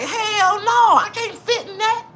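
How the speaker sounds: hammed-up scared shriek; 1 mock terrified cry. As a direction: cry it out in fake horror. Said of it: "I'm like".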